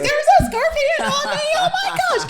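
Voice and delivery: high, loud shriek broken by laughs; wife screaming about a scorpion on her, half laughing; panicked shriek. Retold or imitated. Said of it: "I didn't say it that calm".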